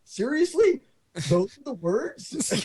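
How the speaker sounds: high, slowed-down mock disbelief; mock incredulity at being told which words to use; incredulous. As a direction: drag out the disbelief as if the answer is absurd. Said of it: "I'm like".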